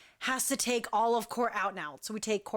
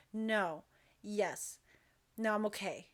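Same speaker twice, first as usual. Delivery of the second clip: low, soft, measured answers; calm; 3 semitones lower, 6 dB quieter than usual. Retold or imitated. Injured dancer answering the paramedics, retold quiet calm. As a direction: imitate someone quietly keeping it together. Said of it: "find your calm".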